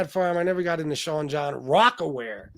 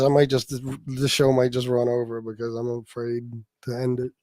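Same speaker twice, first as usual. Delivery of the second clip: ordinary delivery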